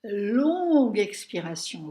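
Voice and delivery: slow and loud, breathing out long; long exhale. Shown as a demonstration. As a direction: drag it out on one long breath. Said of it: "Alors, regardez".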